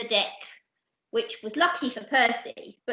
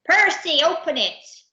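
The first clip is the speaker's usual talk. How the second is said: loud, raised pirate order; commanding; 7 semitones higher, 7 dB louder than usual. Acted. Captain ordering Percy to open the chest, loud command. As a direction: bark it at a crewman.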